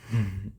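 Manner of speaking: brief, closed-mouth chuckle; a small, knowing laugh to oneself; short chuckle. Acted. Employer amused after revealing a test to the servant, short chuckle.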